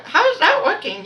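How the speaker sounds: high-pitched, loud incredulous question; retold challenge. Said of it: "I told her".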